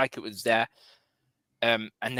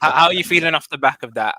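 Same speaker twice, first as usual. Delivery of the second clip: loud host question; 4 semitones higher, 11 dB louder, faster than usual. Real, not acted. Host calling to guest.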